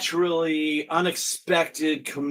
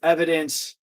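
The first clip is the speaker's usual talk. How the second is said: usual speaking voice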